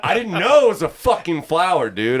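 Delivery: loud, high-pitched disbelief; exasperated protest. Retold or imitated. Telling Kenny he misunderstood the flower, loud and exasperated. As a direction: re-enact flustered self-defense at the top of the range.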